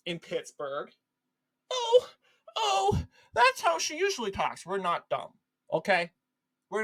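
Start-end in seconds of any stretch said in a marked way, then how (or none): 1.7-4.7 high, whiny mocking excuse; hammed-up high-pitched cover-up; mock media apologist. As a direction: imitate a gushing defender in a high voice. Mimics media excusing Harris's accent.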